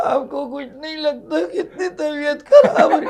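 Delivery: high, plaintive complaining wife; whiny wife. Acted. Wife scolds husband for not caring, high and whiny. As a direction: whine for sympathy.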